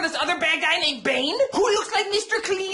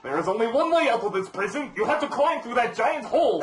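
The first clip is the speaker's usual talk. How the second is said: masked villain in a lower, put-on voice; Bane; 7 semitones lower than usual. Bane explaining the prison to Batman, villain voice. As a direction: lay down the rules as the villain. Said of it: "And Bane is like".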